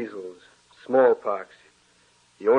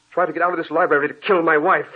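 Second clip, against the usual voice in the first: fast, louder rush of words; frantic outburst; 4 semitones higher, 5 dB louder, faster than usual. Acted. Husband warning doctor of the ghost, frantic and fast. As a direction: blurt the horror out quickly.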